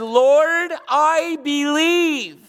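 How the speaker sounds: high, long drawn-out cry to God; tearful cry out. Retold or imitated. Sobbing father cries out to Jesus, dramatised as a loud drawn-out plea. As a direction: imitate a desperate cry, words stretched. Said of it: "he cried out and he said unto him with tears, he's sobbing".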